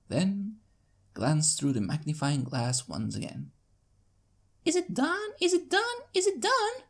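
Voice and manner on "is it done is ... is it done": high, eager little filly pestering; young filly begging to know, much higher; excitable filly. Acted. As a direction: bounce with impatience, pitched up high. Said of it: "an excitable filly ... yelling in your face".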